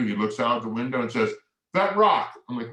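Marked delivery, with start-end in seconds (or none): 1.8-2.3 put-on eager kid. Kid answers dad's question.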